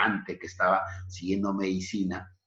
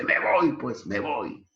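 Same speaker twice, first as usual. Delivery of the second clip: indignant exit; 6 semitones higher than usual. Acted. Carlos storms out of the house.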